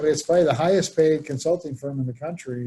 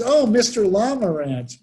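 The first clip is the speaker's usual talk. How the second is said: delighted recognition; 6 semitones higher, 4 dB louder than usual. Retold or imitated. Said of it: "He goes".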